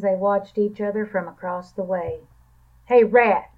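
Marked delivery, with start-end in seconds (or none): 2.8-3.5 loud barked call. A narrator's reading. Dog barking at Rat across the junkyard. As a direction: bark his name across the yard. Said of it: "barked Dog".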